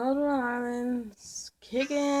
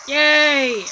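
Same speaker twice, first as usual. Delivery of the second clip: excited cheer; 3 semitones higher, 10 dB louder than usual. Real, not acted. Cheering at cherries.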